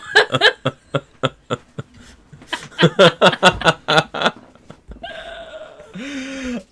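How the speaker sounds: loud laughter. Real, not acted. Reacting to the old man voice.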